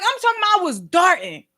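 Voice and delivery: normal talking voice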